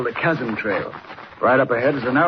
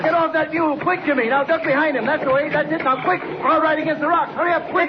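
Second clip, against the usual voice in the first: very high, rushed urgent orders; panicked shouted instructions; frantic commands; 13 semitones higher than usual. Acted. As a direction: drive the boy to cover fast. Urging the boy to shelter.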